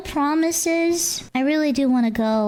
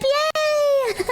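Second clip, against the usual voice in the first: high, bright whoop of joy; excited cheer; 12 semitones higher than usual. Real, not acted. Excited about the field trip.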